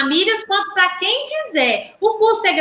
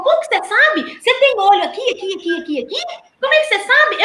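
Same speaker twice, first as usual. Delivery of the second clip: higher, amazed questioning; a caught student quizzes his teacher, amazed and higher; astonished student; 3 semitones higher, faster than usual. Retold or imitated. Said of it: "Ele falou".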